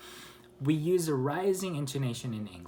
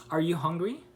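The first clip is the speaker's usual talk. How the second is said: higher, with "hungry" lifting; rising intonation; 5 semitones higher, 4 dB louder than usual. Shown as a demonstration. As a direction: send the pitch up on "hungry". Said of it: "So the intonation goes up".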